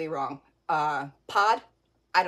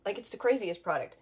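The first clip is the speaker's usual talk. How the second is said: ordinary delivery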